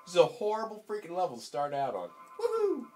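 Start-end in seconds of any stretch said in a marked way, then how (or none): 2.4-2.9 very high whooping cheer. Real, not acted. Gamer cheering his character on.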